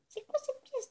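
surprised. Real, not acted. Finds an odd coin.

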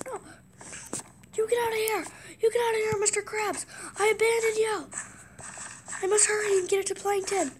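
high, loud frantic cartoon voice; shouting like a panicked cartoon sponge; frantic SpongeBob. Gamer role-playing SpongeBob fleeing Mr Krabs, high and frantic.

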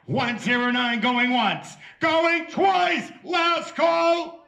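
loud, rising auction countdown; auctioneer call. Acted. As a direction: call the bids out to the room. Auctioneer calling bids.

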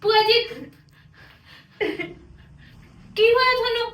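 high, loud cry of concern; alarmed calling. Acted. Niece finds her aunt crying, calls.

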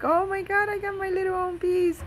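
high squealing re-enactment of delight; gleeful high-pitched squeal; overjoyed. Retold or imitated. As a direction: squeal it high, replaying your happy reaction. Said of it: "I was so happy".

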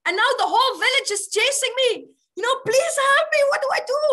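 high, loud, desperate plea; panicked tiger. Acted. Tiger chased by villagers begs the yogi, panicked. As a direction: beg frantically, playfully acted.

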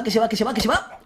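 ordinary talk, nothing marked

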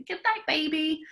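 high gentle goodnight to a doll; sing-song goodnight. Shown as a demonstration. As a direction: coo it high to the baby doll. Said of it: "playing with quiet noises".

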